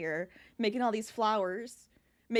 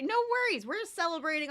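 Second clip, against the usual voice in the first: excited; 8 semitones higher, 4 dB louder than usual. Real, not acted. Greeting viewer, eager reassurance.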